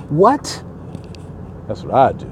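ordinary talk, nothing marked

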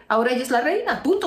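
usual speaking voice

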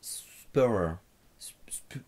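whispering the name to himself; whispered try. Real, not acted. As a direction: rehearse it under your breath. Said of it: "désolé pour la prononciation".

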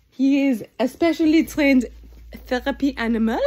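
ordinary delivery

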